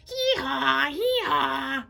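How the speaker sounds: bawling bray. Acted. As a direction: keep braying in distress. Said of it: "bawled the donkey".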